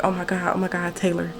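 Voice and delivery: hushed, urgent retold fluster; freaking out. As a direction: quietly imitate a flustered plea for attention. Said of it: "I was visibly freaking out".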